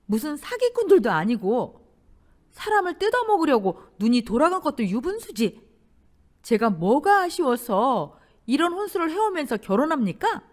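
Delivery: firm. A narrator's reading. Bride standing up to her future in-laws, firmly. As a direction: stand your ground forcefully. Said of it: "조용히 단단히 말했습니다".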